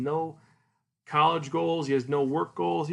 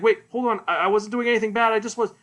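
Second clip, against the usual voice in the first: high, loud, hurried protest; acted panicked scrambling to explain; freaking out; 7 semitones higher, 5 dB louder, faster than usual. Caught breaking into the club room, panicked. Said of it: "She freaks out, is like".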